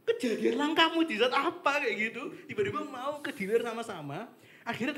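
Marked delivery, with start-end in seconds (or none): 0.1-1.8 louder, higher, laughing; laughing quote. Retold or imitated. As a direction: ask it through laughter. (tertawa sambil bertanya)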